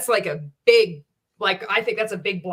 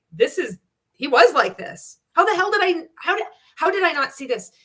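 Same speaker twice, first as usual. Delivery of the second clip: higher-pitched retold disbelief, building in pitch; incredulous realization; 8 semitones higher, 5 dB louder than usual. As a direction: replay the shock of suddenly realizing it. Acted astonished reaction to old training videos.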